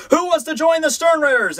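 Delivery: high herald's call to a crowd; mock declaration. Soldier addressing a random village, high mock declaration. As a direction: announce it high, like a town crier. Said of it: "just declare".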